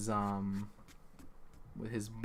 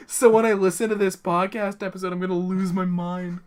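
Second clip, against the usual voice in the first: high, raised voice easing slightly; loud exasperated outburst; 7 semitones higher, 14 dB louder than usual. Real, not acted. Game master dreading the podcast playback, loud high outburst. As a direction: blurt it out loud, half hysterical.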